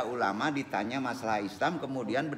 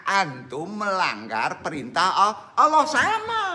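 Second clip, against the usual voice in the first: louder, higher, slow hammering of "perintah"; forceful preaching; 6 semitones higher, 6 dB louder, slower than usual. Acted. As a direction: preach it like a command from above. (ceramah berapi-api, tegas dan lambat)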